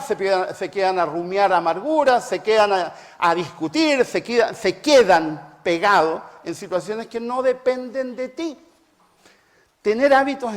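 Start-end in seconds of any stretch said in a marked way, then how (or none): none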